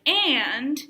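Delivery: "and" stretched out loud with a pitch swoop; emphatic AND. Shown as a demonstration. Teacher showing a varied word, emphatic "and". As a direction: lean hard and long on "and". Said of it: "saying the words like".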